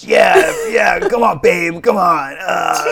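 hammed-up sleazy Hollywood type, half shouting; toxic Hollywood voice. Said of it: "gross Hollywood voice".

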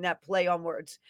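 ordinary talk

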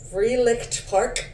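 slow careful German Freilichtpark; German pronunciation. Shown as a demonstration. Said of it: "It sounds German".

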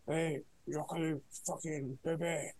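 a lightly put-on French thug accent; mock French accent. Mocking the French terrorists in a mild put-on French accent. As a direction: do a half-hearted French terrorist. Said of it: "they're like".

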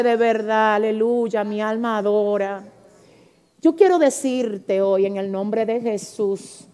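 voz pausada, baja y suave de predicadora conmovida; oración íntima. Real, not acted. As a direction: ruega despacio, como entregándote a Dios. (oración sentida, lenta y recogida)

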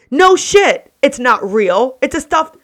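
loud, high-pitched comeback; indignant, punched-up outburst; exasperated retort. Retold or imitated. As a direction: burst out at the obvious.

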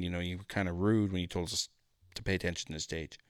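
low, soft and hesitant; subdued, tactful retelling; careful, gentle broaching. Retold or imitated. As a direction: break bad news quietly.

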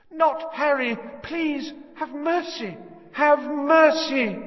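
high, loud pleading woman's voice; desperate screaming plea. A narrator's reading. A mother begging the killer to spare Harry, desperate screams. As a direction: beg for the child's life, terrified. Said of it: "The woman was screaming".

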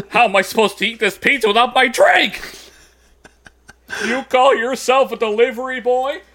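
high, loud, outraged yell; angry shout. Acted. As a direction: rant like an angry customer. Acting out the angry customer.

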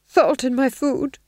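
high, loud, slow anxious voice; nervous Duke. A narrator's reading. Duke fretting over false rumours, nervous high voice. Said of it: "said the Duke nervously".

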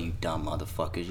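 ordinary talk, nothing marked

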